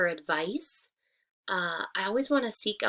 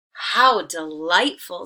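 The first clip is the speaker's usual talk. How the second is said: slow, louder exclamation of pleasure; delighted; 10 dB louder, slower than usual. A narrator's reading. Baboon enjoying the sun through his umbrella, delighted. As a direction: savour the sunshine in the words.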